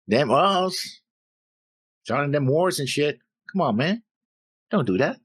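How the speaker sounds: put-on high Southern accent that slips; Southern accent falling apart. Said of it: "And there goes my Southern accent".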